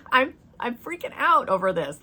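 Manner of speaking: high, loud, laughing through the words; half-laughing, caught up in the thrill; excited laughing. Real, not acted. Laughing to viewers about the vision, excited. Laughing while retelling a shock.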